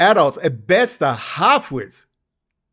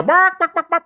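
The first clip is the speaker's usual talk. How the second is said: mock barking; 11 semitones higher than usual. Mocking critics as dogs.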